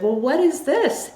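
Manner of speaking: lifted, acted-out wondering; curious surprise. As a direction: voice a guest-receiving host's intrigued question. Voices Oprah's curious reaction to gift.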